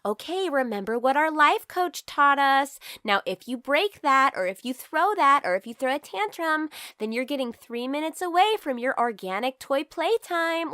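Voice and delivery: mock the gentle-parenting mom in Target; mocking life coach parent. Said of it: "they're just like".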